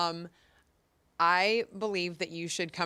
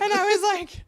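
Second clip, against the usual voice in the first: higher and louder through laughter; laughing; 7 dB louder than usual. Real, not acted. Woman retelling her bad date, laughing hard. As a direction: still cracking up as she talks.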